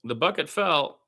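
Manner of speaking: lively radio play-by-play call of a Spurs game. Acted. Fan practicing radio play-by-play.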